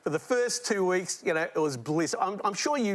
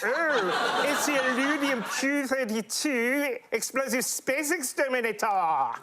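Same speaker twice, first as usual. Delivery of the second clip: cartoon voice; 7 semitones higher than usual. Marvin quote in cartoon voice.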